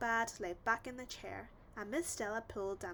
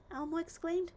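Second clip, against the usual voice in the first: usual speaking voice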